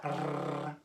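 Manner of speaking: a long trilled "R"; rolled R. Shown as a demonstration. Host asking children to copy, rolled R. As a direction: trill the tongue on a drawn-out "R". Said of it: "You have to roll the R".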